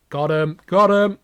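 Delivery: loud, rising crow; gleeful gloat. Acted. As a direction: cheer the victory.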